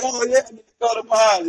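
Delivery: put-on high, eager little-kid voice; excited kid. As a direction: replay the thrill of getting to go to pause. Retelling his own excitement as a kid.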